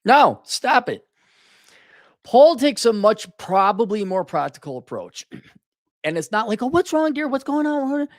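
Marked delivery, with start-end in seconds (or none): 0.1-1.1 loud, slow, high-pitched command; sharp scolding. Retold or imitated. Man telling crying girlfriend to quit, sharp scolding. Acted order to stop crying. 6.6-8.1 high cooing mock concern; mock sympathy. Man parodying a doting partner, mock sympathy.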